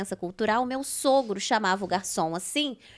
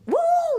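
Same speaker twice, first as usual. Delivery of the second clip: mock yelp; 5 dB louder than usual. Mother and she shouting.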